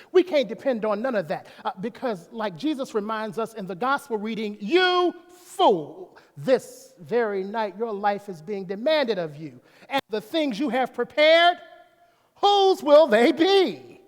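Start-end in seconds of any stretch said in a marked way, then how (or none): 4.6-14.0 thundering rebuke. Acted. Preacher voicing Jesus rebuking the rich fool, thundering slow rebuke. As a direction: cry it out like a judgment from the pulpit.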